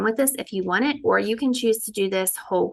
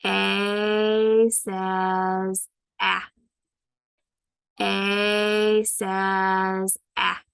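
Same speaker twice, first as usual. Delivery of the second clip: very slow, stretched repeat; slow modelled sound; much slower than usual. Shown as a demonstration. Teacher repeating the sound slowly for students. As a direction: stretch each sound out for kids. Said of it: "Let's do it again".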